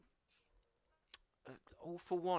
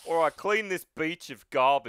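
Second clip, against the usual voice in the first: loud Australian order; heavy Australian accent; 5 dB louder than usual. Acted. All Might ordering Deku to clean up, heavy Australian accent. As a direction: call it out in broad Aussie. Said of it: "Heavy Australian".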